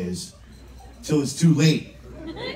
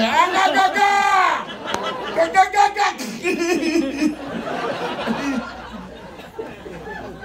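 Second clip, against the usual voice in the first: high, choked repeated "ak" noises; gagged cries; 14 semitones higher than usual. Acted. Imitating being tied up and gagged, choked cries. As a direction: garble the safe word through a gag. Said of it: "I was tied up and gagged".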